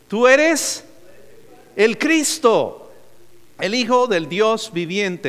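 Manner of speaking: high, loud and slow, each phrase held; proclaimed confession. Acted. Preacher voicing Peter's confession to the congregation, loud proclamation. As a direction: ring out Peter's words like a declaration.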